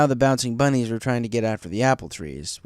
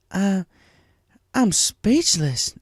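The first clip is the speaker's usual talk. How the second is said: higher, slowed stunned drawl; amazed Applejack; 8 semitones higher, 3 dB louder, much slower than usual. A narrator's reading. Applejack amazed by Fluttershy's bunny roundup, higher and slow. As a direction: let the words fall out in awe. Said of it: "simply amazed".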